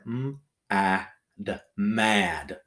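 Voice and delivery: m-a-d as separate letter sounds, then the whole word mad; sounding out. Shown as a demonstration. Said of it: "Sound it out".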